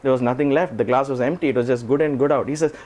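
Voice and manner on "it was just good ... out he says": usual speaking voice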